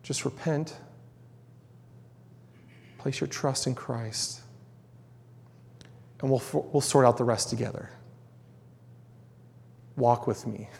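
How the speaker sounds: hushed pleading call to trust Christ; tender invitation. Real, not acted. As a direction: invite them in quietly and tenderly.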